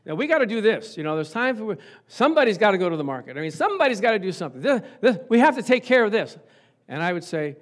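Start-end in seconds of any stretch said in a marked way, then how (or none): 0.0-6.4 louder, pushy, insistent orders; a little bossy. Shown as a demonstration. Giving the household orders in voice number two, bossy. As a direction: press harder as you hand out orders. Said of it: "has a little more direction to it, and yay, someone might say, a little bossy".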